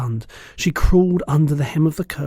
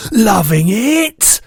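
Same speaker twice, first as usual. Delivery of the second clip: high, slow, much louder yell; 9 semitones higher, 9 dB louder, much slower than usual. A narrator's reading. Bloodbottler yelling at the BFG in disgust. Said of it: "yelled the blood bottler".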